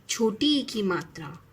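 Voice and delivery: ordinary talk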